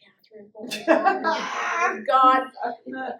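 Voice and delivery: hammed-up horror, half whispered; mock shocked friends. Friends reacting to her plan, laughing in disbelief. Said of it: "they were like".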